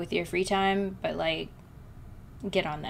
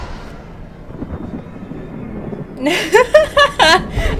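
high, loud cackling laugh; 12 semitones higher, 6 dB louder than usual. Real, not acted. Streamer realizing a forgotten setting, laughing loudly. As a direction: burst out laughing at your own slip.

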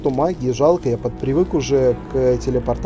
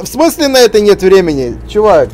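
indignant; 6 semitones higher, 9 dB louder than usual. Real, not acted. Mocking indignation at game character.

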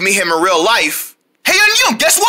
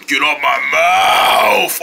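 slow low creature yell; angry yell; 9 semitones lower, slower than usual. Acted. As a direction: roar it slowly, deep. Creature yells.

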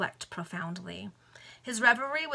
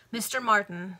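ordinary delivery

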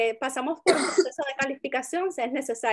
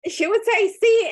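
excited laugh; 9 semitones higher, 7 dB louder than usual. Real, not acted. Laughing retelling of client.

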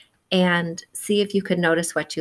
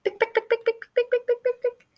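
high, quick clicking heel taps. Acted. Narrator voicing the lady's running heels, high clicks.

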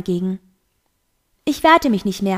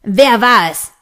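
very loud, angry demand; short explosive roar; furious roar; 8 dB louder, slower than usual. A narrator's reading. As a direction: bellow for the culprit. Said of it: "brüllte er".

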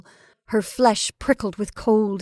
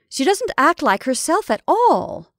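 indignant; 7 semitones higher, 6 dB louder than usual. A narrator's reading. Indignant remark on Rebecca's behaviour.

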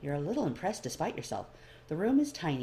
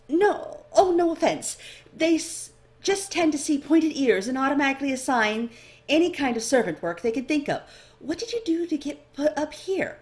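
much higher, louder chatty elf voice; bright curious clerk sizing up the newcomer; eager elf librarian; 10 semitones higher, 8 dB louder than usual. A narrator's reading.

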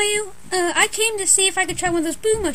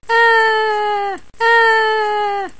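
loud wailing cry of alarm; crying shout; 3 semitones higher, 6 dB louder than usual. Acted. Luigi caught by a ghost, loud panicked wail. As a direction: yell out in panic.

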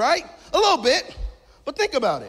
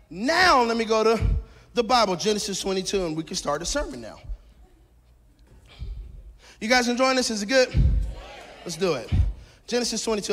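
normal talking voice

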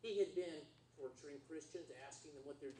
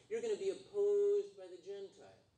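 emphatic warning; 3 semitones higher, 7 dB louder than usual. Acted. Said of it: "as it says in these next verses".

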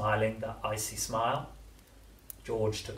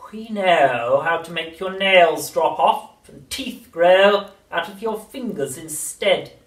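very slow, throaty, menacing crone; throaty witch whisper; 8 semitones higher, 14 dB louder, slower than usual. A narrator's reading. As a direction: lean in and menace. Grandma menacing.

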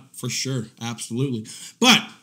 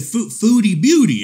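incredulous; 6 semitones higher, 6 dB louder than usual. Retold or imitated. Incredulous repeat of name.

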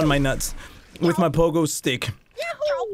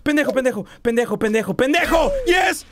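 angry cursing; 7 semitones higher, faster than usual. Real, not acted. Cursing the game character.

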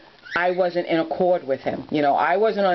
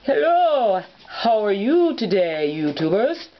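Mister Scotty; 3 semitones higher than usual. Shown as a demonstration. Toy Scottish Terrier greeting the YouTubers, in character voice. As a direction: greet them slowly as a toy dog with a big swooping hello. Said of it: "But with Mister Scotty".

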